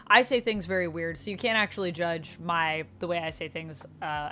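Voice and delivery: normal talking voice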